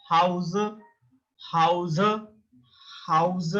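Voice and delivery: "House" as German HOW-zuh, breathed h; German pronunciation. Shown as a demonstration. Teacher models German H for learners, German pronunciation. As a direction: sound the final vowel in "House". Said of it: "It's not H".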